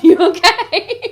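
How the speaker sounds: slow, high and loud laughing. Real, not acted. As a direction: laugh through the question. Laughing after cough.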